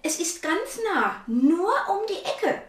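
luring stranger. Acted. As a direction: coax the child along. Stranger lures girl, voiced quote. (lockender Fremder, höher und lauter)

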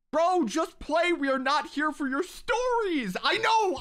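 high, loud whiny mock of a viewer telling him to quit yapping; mock impatient viewer. Reading a viewer's complaint about his stories, in a high whiny mock voice. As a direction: whine it like an annoyed chatter demanding he just play.

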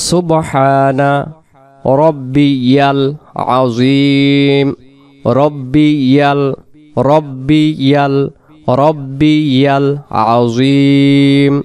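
slow সুবহানা রব্বিয়াল আজিম with long-held vowels; correct recitation. Shown as a demonstration. Teacher modelling how we will say it, slow recitation. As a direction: stretch আজিম, pausing between the words. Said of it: "তাহলে আমরা কিভাবে বলবো?".

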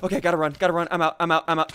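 ordinary talk

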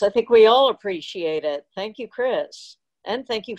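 ordinary talk

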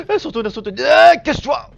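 high, panicky cry building to a shout; panicked. Real, not acted. Player about to be seen, panicked. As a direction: blurt it loudly in sudden alarm. Pursuer turns, player must hide.

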